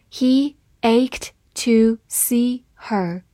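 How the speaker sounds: slow, word by word; slow reading. Shown as a demonstration. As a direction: read it slowly and clearly for learners. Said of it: "好，慢读一遍。".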